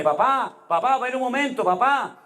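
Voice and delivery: high, insistent call of a confused daughter. Retold or imitated. Confused sick daughter calling her father from bed, high and insistent. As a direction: call out papá up high, pleading, each one falling.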